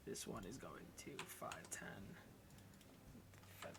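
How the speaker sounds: counting moves in a whisper. Real, not acted. GM counting squares under breath.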